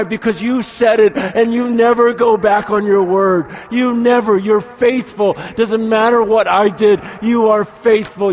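preacher rousing the congregation to trust; fervent preaching. Acted. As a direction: build it up with joyful conviction. Preaching fervently about promises.

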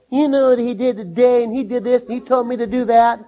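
lightly voiced office gossip; gossiping coworker.

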